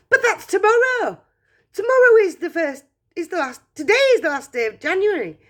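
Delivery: breathless rush of a flustered mother; excited mother. Acted. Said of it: "cried Missus Bucket".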